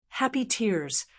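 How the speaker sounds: voice breaking. A narrator's reading. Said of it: "I whispered, my voice breaking".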